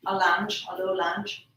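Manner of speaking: ordinary talk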